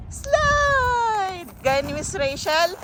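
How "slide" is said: excited cheer. Real, not acted. Cheering kid down slide.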